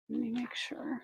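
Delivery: unvoiced whisper while checking; quietly talking to herself as she checks; whispered aside. Real, not acted. Seller checks the pendant measurement, whispering to herself.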